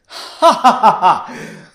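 slow, loud hearty ha ha ha; loud acted laughter. Margot bursts out laughing at the scene, loud and free. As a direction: let the laugh burst out of her. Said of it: "Margot broke out in laughter".

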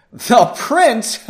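high, loud scoffing question; laughing mage. Acted. Lead mage laughs off the prince, high and loud. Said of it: "The lead mage laughed".

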